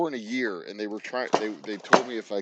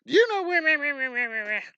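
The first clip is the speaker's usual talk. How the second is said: much higher, squeaky mock voice asking where; frantic high-pitched girl; 3 dB louder than usual. A guy mimicking a frantic girl at the front desk, squeaky and much higher. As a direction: imitate her as a shrill-sounding caricature. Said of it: "she's like".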